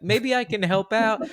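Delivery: high, falsely helpful offer; politely volunteering while hiding dread; fake nice. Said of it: "Just being nice".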